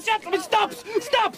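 rising frantic cry of stop, stop; desperate plea. Acted. Prisoner begging the soldiers not to hang him, frantic. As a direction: beg them to stop, voice climbing high.

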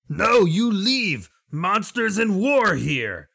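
slow, high and loud broken-speech lizard shout; game master voicing the lizard, ordering the party away; lizardfolk yell. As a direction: bark out a warning like a wary lizard guard. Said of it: "the lizard will yell again".

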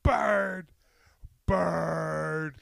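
high loud parrot squawk. Pretend. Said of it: "parrot squawk".

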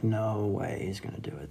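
hushed disbelieving whisper. Retold or imitated. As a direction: whisper it under your breath in the back seat. Quiet disbelief in the car.

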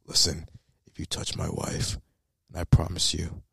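low, half-whispered mock Batman threat. Reviewer mocking Ben Affleck's threats, low whispered Batman voice. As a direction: drop low and hush it like Batman. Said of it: "turned on his Batman voice".

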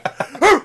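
high, loud comic barks; yelping like a cartoon animal; imitated barking. Imitating Freund barking in the toilet, comic yelps.

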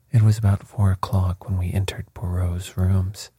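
ordinary delivery

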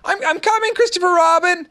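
very high squeaky call; little Roo squeaking to Christopher Robin; squeaked. A narrator's reading. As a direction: squeak it out eagerly. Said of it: "he squeaked out".

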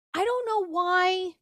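high, slow, drawn-out I don't know why; whining it out in disbelief; high exasperated. Real, not acted. Puzzled at her own new dating kick, high and drawn out. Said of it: "exasperated repeat".

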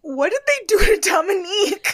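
high, loud, laughing outrage; outraged disbelief. Real, not acted. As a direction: cry out in shocked disbelief. Outraged reaction to haircut.

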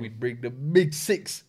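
usual speaking voice

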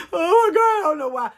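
high, loud mock wail of a cheated woman; mock crying wail. Mocking a crying woman, high whiny wail. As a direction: wail it like a pitiful crying girlfriend.

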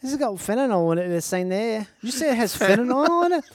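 garbled mock Scottish; put-on, barely intelligible Scottish; Scottish accent. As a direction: ramble in a thick Scottish accent. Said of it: "Imagine ordering bagels in Scotland".

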